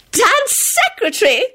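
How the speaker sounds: shrieked question. A narrator's reading. Rosie learns who the guest is, shrieking in a contained way. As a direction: squeal it in high-pitched disbelief. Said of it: "Rosie shrieked".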